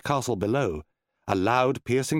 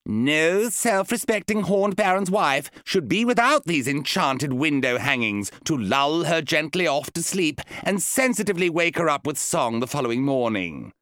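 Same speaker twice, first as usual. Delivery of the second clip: high, insistent wife pestering her husband; demanding wife; 5 semitones higher, 3 dB louder than usual. Acted. Wife Ingrid demanding enchanted curtains from her husband, high and insistent. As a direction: wheedle and demand like a spoiled baroness.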